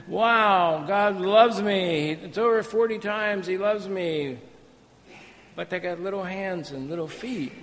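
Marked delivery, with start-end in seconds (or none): 0.1-4.4 mock churchgoer. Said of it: "and they're".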